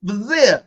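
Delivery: "Brazil" with the R swallowed; Brazil without the R. Real, not acted. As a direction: say "Brazil" as Buh-zil, no R at all. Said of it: "there's an R in there somewhere".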